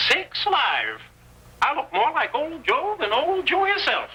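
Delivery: put-on old Joe's voice. Robber in stolen clothes tries out old Joe's voice. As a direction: slip into the old-timer's voice to test the disguise.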